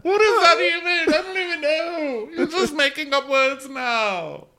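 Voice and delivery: very high wail breaking into laughter; mock crying. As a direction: imitate sobbing in a squeal. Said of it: "so she cries".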